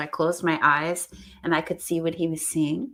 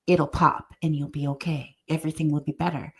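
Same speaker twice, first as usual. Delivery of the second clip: ordinary delivery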